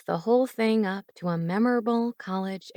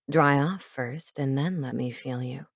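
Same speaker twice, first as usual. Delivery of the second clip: low seductive man; 6 semitones lower than usual. Acted. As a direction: purr it low and close. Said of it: "he challenged".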